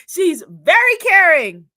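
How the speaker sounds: loud, high, slow bad Guyanese accent. Daughter reads out mom's comment about auntie, bad Guyanese accent. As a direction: put on a hammed-up Guyanese accent. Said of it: "read this in a very bad Guyanese accent".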